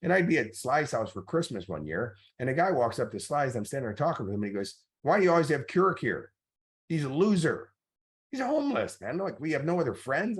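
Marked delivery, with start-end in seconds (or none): 5.0-10.4 sneering partygoer. Retold or imitated. Party guest sneering at Kirk in front of Sly, high mocking voice. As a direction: sneer at the homeless guy.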